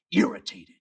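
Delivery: usual speaking voice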